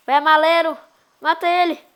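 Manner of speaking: loud encouraging call; cheering shout. Real, not acted. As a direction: urge a teammate on loudly. Cheering teammate on.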